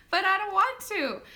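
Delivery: loud, high mock whine; retold whiny protest. Labouring mom arguing back at nurse, whiny protest. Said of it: "arguing with my nurse".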